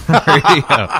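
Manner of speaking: slow, laughing radio welcome. Real, not acted. Host opening the show, laughing through it. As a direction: chuckle through the station intro.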